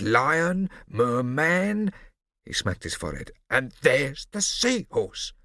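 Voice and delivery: slow marvelling list; amazed recognition. A narrator's reading. Barbarossa recognizing the roundabout, slow and amazed. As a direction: savour each name in wonder.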